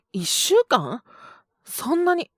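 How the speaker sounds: can't believe it's a whole week; shocked disbelief. Acted. Shocked by week-long stay.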